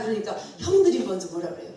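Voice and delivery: normal talking voice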